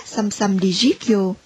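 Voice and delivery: plain reading voice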